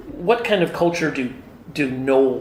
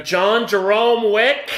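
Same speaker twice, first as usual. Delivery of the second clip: higher, slow mock-stern mother calling out a full name; mock scolding mom; 7 semitones higher, slower than usual. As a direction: play a mom about to scold, drawing out each name. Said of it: "she uses that voice".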